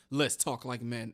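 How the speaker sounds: low, mocking tough-guy voice; mock macho line. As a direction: drop deep to mock his man-to-man talk. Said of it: "you talking about some".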